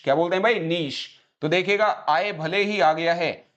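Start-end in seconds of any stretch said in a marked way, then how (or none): 0.6-1.2 नीश repeated as 'neesh', softer; correct pronunciation. Shown as a demonstration. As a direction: drill नीश with long ee and 'sh'. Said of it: "इसको बोलते हैं नीश". (नीश का सही उच्चारण, लंबी 'ई')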